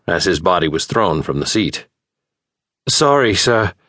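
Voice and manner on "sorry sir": called out. A narrator's reading. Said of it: "called over his shoulder".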